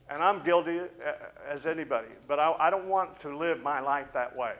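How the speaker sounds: loud, ringing confession from the pulpit; preaching at full force. Acted. Preacher confessing his own guilt, loud and ringing. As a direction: lift it up and preach it out.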